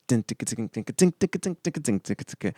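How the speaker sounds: high, soft ticking loop sound; imitated machine loop. Artist mimicking the producer's looped machine sound, vocal imitation. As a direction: mimic a looping drum machine, high and quiet. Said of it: "he was playing it and looping it, and it goes".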